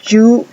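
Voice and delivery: You said as "joo" with a hard j; You begun like the j of Joe. Shown as a demonstration. Teacher shows the sound needing J, You as "joo". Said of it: "el sonido you".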